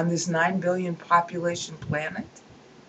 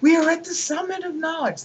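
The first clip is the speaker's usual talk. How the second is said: much higher, sneering grandeur; mocking pompous quote; 10 semitones higher, 4 dB louder than usual. Lecturer ridiculing a scientist's boast, high and sneering.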